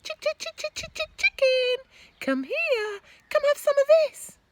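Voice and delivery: high, loud coaxing call; boy calling a hen over; coaxing chicken. Acted. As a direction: lure the hen.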